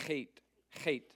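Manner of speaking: "chet" with a rasp at the back of the throat; "chet" with a throaty ch, like Bach; guttural letter. Shown as a demonstration. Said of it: "Back in the throat".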